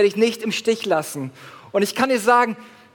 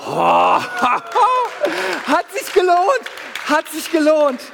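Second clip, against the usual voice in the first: higher, delighted little cry; pleased relief; 7 semitones higher, slower than usual. Retold or imitated. Preacher acting his pleasure after training, mock delight. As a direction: savor it after the workout. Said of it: "denk ich so".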